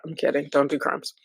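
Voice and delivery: usual speaking voice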